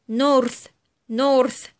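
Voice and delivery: "north" ending on breathed th; voiceless TH. Shown as a demonstration. Teacher gives vowel examples, "north" with voiceless th. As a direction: model "north" twice with unvoiced th. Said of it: "وتنطق ث".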